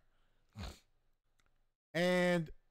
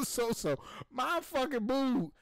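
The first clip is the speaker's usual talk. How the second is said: usual speaking voice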